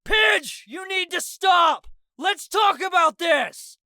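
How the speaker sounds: high, loud, slow pleading shout. A narrator's reading. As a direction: shout over the noise, slow and urgent. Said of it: "I shouted over him".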